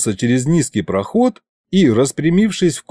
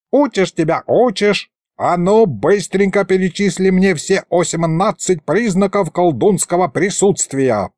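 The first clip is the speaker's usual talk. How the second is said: exasperated yelling; 7 semitones higher than usual. A narrator's reading. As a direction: nag like a fed-up old teacher. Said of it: "завопил Мировид". (ворчливо распекая ученика, повыше)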